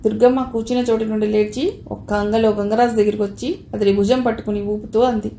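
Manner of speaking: usual speaking voice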